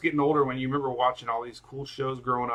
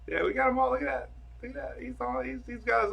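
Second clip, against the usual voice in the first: high-pitched cooing over pictures; high, doting pet talk; pet-gushing voice; 10 semitones higher, faster than usual. Real, not acted. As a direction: gush in a high little voice. Gushing over dog photos.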